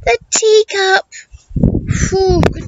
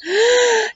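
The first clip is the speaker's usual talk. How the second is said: gasp; 5 semitones higher than usual. Acted. Reader gasps.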